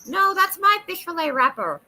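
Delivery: high, loud squawky crow; be a greedy crow guarding its food. Acted. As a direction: crow defending its fish head. Voicing the crow.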